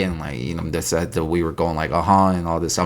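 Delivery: hesitant mimicked backpedalling; stumbling over words. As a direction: imitate someone trailing through excuses. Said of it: "stumbling over their words".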